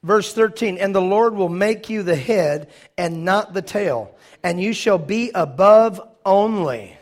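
slow, loud emphatic reading; preaching scripture. Acted. Preacher declaring scripture to congregation, slow loud preaching. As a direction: proclaim each phrase slowly.

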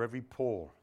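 low, soft, hushed closing prayer. Real, not acted. Preacher praying for congregation's understanding, hushed prayer.

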